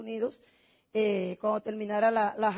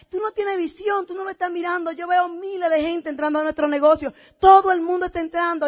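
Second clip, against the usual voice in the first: higher-pitched, emphatic husband's rebuke; insistent retort; 5 semitones higher, 5 dB louder than usual. Retold or imitated. Husband correcting his doubting wife about the business, insistent. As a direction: argue it with total conviction, building louder.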